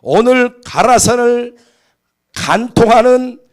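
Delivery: put-on heavy Gyeongsang accent, words drawn out. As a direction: orate like a Gyeongsang president at a ribbon cutting. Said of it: "경상도 말로 한 겁니다".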